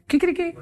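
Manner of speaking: high rooster crow, kikiriki. Retold or imitated. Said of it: "¿Aquí cómo hacen en España?". (canto de gallo agudo, kikiriki)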